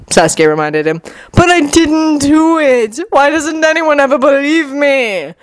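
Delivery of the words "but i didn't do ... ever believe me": high, drawn-out indignant wail; demanding Orochimaru. A narrator's reading. Orochimaru demands to be believed, high drawn-out protest. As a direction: demand it high, stretching out the complaint. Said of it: "Orochimaru demanded".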